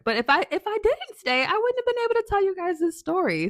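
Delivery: spring up with sudden excitement; excited. Real, not acted. Telling viewers she stayed for the story, excited.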